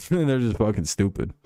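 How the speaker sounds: usual speaking voice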